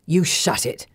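rough, angry snap; angry villain. Acted. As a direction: bark it at her. Falson snaps at captive.

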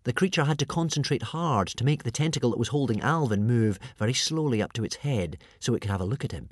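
ordinary delivery, nothing marked

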